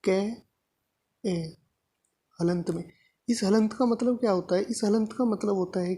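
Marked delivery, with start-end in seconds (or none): 0.0-1.6 showing students how the syllable breaks apart; split sound demo. Shown as a demonstration. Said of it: "यदि मैं इसको ऐसे तोड़ दूँ".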